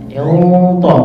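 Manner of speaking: "ilto" full and round, heavy t; heavy tafkhim. Shown as a demonstration. Teacher shows a thick, heavy "ilto". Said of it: "suaranya lebih tebal, lebih berat".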